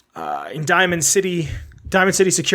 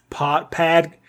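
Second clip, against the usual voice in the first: "pad" said with an open Boston a, mildly imitating Bill Burr; light Boston twist turning "Pod" into "pad"; Boston accent. Showing how Bill Burr says "Pod" as "pad" in a Boston accent. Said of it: "how does he say it?".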